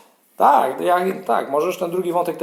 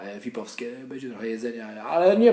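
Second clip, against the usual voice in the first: ordinary talk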